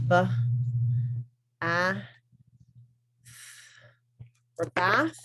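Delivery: "b", "a", "th" said as separate sounds, then blended into "bath"; sounding out. Shown as a demonstration. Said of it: "finger tapping card for b, a, th".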